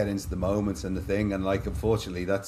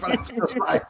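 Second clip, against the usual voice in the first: real delighted laugh rising high; 4 dB louder than usual. Real, not acted. Retelling the moment he felt bulletproof, real high laughter. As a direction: burst out laughing, then break into an airless laugh. Joyful laugh at the turning point.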